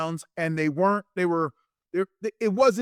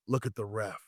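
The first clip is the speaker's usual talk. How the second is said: low, soft secret aside; hushed imitation of a whispered cue; retold whisper; 6 semitones lower, 9 dB quieter than usual. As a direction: slip it in under the breath. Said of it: "he whispers in my ear".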